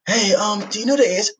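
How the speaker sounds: high, sweet fake innocence; high innocent voice. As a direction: play dumb in a high voice. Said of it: "He said".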